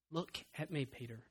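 very soft, hushed call; tender call. Acted. Jesus calling to Peter sinking in the water, hushed. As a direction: barely whisper it to Peter.

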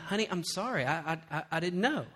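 soft, stammered apology; meek mock apology. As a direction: shrink back and apologise quietly. Acted meek apology in quarrel.

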